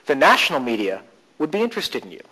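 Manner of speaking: "national" punched, the rest lighter; stress on NATIONAL. Shown as a demonstration. Coach shifting stress to challenge a belief, stress on "national". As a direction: hit the word "national". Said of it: "stress in different places".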